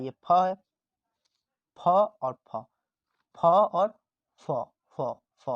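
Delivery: फ with both lips, फ़ with teeth on lip, loud; फ and फ़ contrasted. Shown as a demonstration. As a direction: contrast फ and फ़ sounds loudly. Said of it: "दोनों का उच्चारण स्थान". (फ और फ़ का उच्चारण, ज़ोर से)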